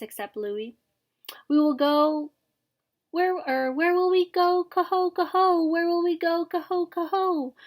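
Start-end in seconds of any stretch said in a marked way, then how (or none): 1.5-7.5 put-on high, loud chanting young birds; cygnets' chorus cry. A narrator's reading. Cygnets clamour to father about where to go, chanting cry. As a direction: chant it high, honking out the calls.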